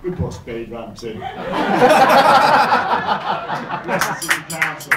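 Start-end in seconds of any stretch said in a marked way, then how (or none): 1.2-3.8 loud audience laughter; the room roars; crowd laughter. Real, not acted. Crowd laughs at pothole joke.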